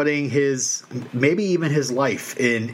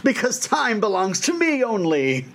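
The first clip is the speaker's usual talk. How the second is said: a hint of a villain, pitched up; hammy villain claim, rising higher at the end; lightly voiced supervillain; 6 semitones higher than usual. Comic villain announcing himself as Clockmaster, mock-menacing.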